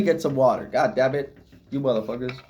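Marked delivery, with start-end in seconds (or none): none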